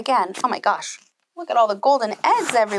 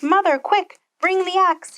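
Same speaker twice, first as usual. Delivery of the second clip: higher, urgent shout for mother; 9 semitones higher than usual. Acted. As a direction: yell for the axe in a panic. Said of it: "he shouted".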